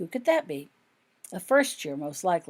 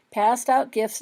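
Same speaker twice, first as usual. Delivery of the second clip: ordinary delivery, nothing marked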